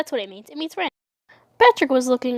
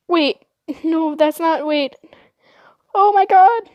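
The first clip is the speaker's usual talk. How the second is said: higher, halting dawning horror bursting out loud; horrified realization; 5 semitones higher, 4 dB louder than usual. Retold or imitated. Girl finding out what the word means, horrified realization. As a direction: play the penny dropping, ending in a loud gasped exclamation. Horrified on learning the word's meaning.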